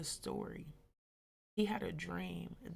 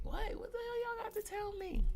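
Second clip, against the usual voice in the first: much higher-pitched questioning; acted wary question. She asks the homegirls their news, high acted questioning. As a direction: re-enact a high, puzzled what. Acts reply to friends' news.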